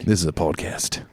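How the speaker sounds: Crocodile Dundee knife line; Australian accent. Pretend. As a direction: deliver it like the outback movie line. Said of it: "you're pretty good at voices".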